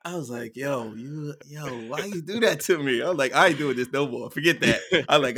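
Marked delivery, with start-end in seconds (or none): 0.6-3.0 higher, louder mock protest; retold exasperation. Retold scolding of prankster daughter. 3.3-4.8 loud, higher fed-up protest; retold exasperation. Retold refusal after the prank.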